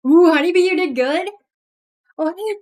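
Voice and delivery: excited. Real, not acted. Greeting a pet excitedly.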